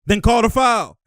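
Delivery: loud, high mock complaint; hammed-up high grievance; imitated player protest. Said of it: "He's like".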